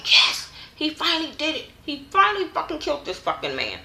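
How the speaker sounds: high, gleeful cheering voice; excited cheer. Retold or imitated. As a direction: cheer like the fan finally got her wish. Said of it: "I was like".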